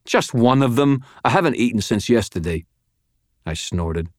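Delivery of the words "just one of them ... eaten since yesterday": higher-pitched, aggrieved snarl; snarling complaint. A narrator's reading. Hungry handcuffed prisoner demanding food, aggrieved snarl. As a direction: complain hotly, pitch pushed up. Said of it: "he snarled".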